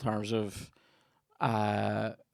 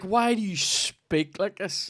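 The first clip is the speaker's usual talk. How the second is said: mock baffled listener; 9 semitones higher, slower than usual. Replying to the commentator, high slow mock voice. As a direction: ask it in a put-on baffled voice. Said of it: "You're like".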